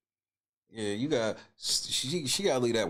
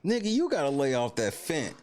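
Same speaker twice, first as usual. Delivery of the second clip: high-pitched incredulous roast; comic outraged ribbing; mocking disbelief; 7 semitones higher than usual. Real, not acted. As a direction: heckle her in high, swooping disbelief.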